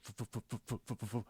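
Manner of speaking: rapid soft f-f-f stutter like a glitch. Shown as a demonstration. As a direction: stutter the sound as if the body glitches. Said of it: "like, glitching back and forth".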